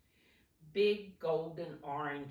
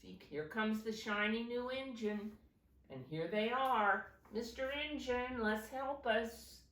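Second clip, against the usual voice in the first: higher, eager toy voices calling; children's toys pleading together, higher; toys crying out; 6 semitones higher than usual. Acted. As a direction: call out to the new engine for help. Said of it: "all the dolls and toys cried out together".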